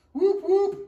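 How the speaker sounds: high, loud mock whoop whoop; a playful cheering whoop. Teacher playfully cheering for a party son, loud whoop.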